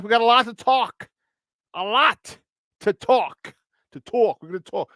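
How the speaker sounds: put-on New York "talk"; playful New York accent, rounded vowel. Shown as a demonstration. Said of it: "This is my New York accent over there".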